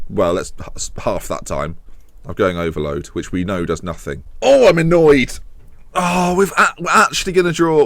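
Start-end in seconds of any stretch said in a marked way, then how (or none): 4.4-7.8 louder, higher frustrated outburst; loud annoyance. Real, not acted. Manager venting at his conceding team, loud annoyed outburst. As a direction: let the annoyance burst out.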